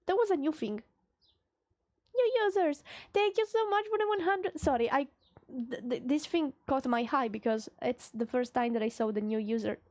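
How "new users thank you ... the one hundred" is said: higher, bright burst of thanks; excited thanks. Real, not acted. Streamer thanking new users, excited and high. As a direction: thank them, thrilled.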